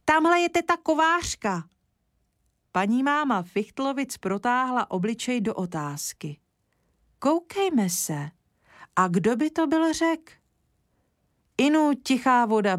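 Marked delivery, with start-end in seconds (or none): 0.0-1.6 calling out. A narrator's reading. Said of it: "zavolal Kája". 7.2-10.3 surprised wondering. A narrator's reading. Mother wonders aloud, surprised.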